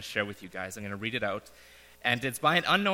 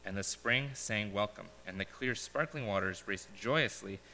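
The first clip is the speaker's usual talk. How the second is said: solemn parable reading with pauses; story narration; 5 dB quieter than usual. Acted. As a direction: read it like a gentle fable to the congregation. Reads a parable aloud.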